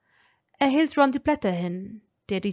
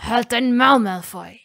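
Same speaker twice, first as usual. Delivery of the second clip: louder, snapping rage; angry snarl; hissed in anger; 8 dB louder than usual. A narrator's reading. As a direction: spit it at him. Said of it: "fauchte Ron".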